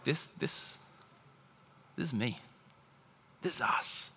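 very soft, near-whispered confession; hushed realization. Retold or imitated. Preacher seeing himself in the scripture, hushed recognition. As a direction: let it sink in, barely voiced.

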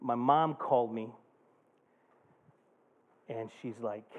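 normal talking voice

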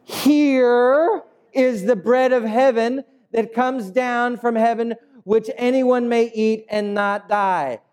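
high, drawn-out proclamation; Jesus straining to explain. Acted. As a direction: stretch the first word, pitched high. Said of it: "Jesus is going like this".

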